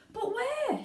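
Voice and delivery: very high shrill cry; a frantic mother shrieking. A narrator's reading. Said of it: "shrieked Missus Salt".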